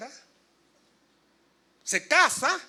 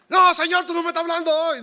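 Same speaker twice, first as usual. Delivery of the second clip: loud, higher, pleading refusal; mock protest; 8 semitones higher, 10 dB louder than usual. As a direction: cry out in comic protest to God. Acted man refusing the verse.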